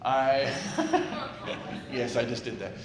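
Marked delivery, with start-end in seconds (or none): 0.6-1.9 burst of laughter. Real, not acted. Laughing at himself.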